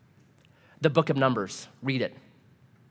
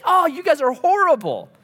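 high, loud mock outrage; exasperated telling-off, pitched up; appalled scolding; 10 semitones higher, 5 dB louder than usual. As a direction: cry out in disgusted disbelief. Acted reproach of Samuel's sons.

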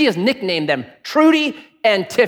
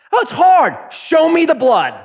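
mock whiny complaint; 9 semitones higher, 4 dB louder, slower than usual. Voicing complainer and Hebrews.

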